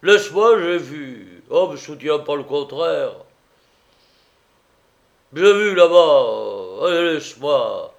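loud, high tipsy protest; boozy, dragged-out objection; drunken master, stubborn. A narrator's reading. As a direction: insist and shake him off.

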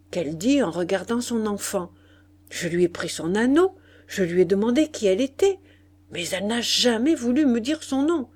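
ordinary delivery, nothing marked

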